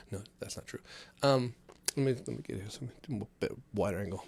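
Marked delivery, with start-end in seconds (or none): 0.1-0.8 hushed half-retraction, just under the breath; whispered aside. Real, not acted. Whispering to listeners that the ex-wife joke isn't true. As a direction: whisper it, taking the joke back.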